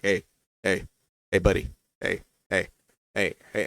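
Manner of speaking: low, soft, repeated prodding; nagging inner voice. Retold or imitated. A thought prodding him as he sulks, quiet nagging. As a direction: nag quietly from the back of the mind. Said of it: "something in the back of my mind".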